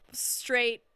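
ordinary delivery

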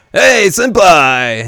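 drawn-out loud, high call; imitating Kanji. Kanji suddenly appearing to greet his senpai, mock shout. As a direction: call out a big, stretched greeting.

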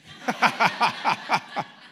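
high, loud choppy ha-ha-ha on command; forced rhythmic laughter; fake laugh. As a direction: laugh at the lie like it's a joke. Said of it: "you're gonna give me your best laugh".

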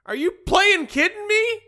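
high, loud disbelief; outraged. Acted. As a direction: throw it out in disbelief. Acted outrage in Mad Lib.

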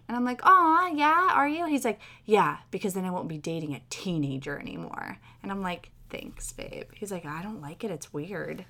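ordinary talk, nothing marked

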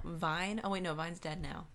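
ordinary talk, nothing marked

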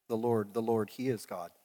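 just recount the shout quietly; mild mock shout.

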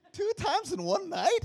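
high, loud, drawn-out disbelief; high loud incredulous wail; exasperated disbelief. Retold or imitated. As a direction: cry out, unable to believe it. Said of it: "I'm like".

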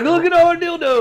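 high, loud gleeful shout; hammed-up delight; mock family excitement. Voicing family in the car.

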